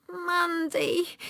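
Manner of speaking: small, high tearful voice; high-pitched weepy little girl; weeping. A narrator's reading. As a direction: give your name through tears. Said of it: "I wept".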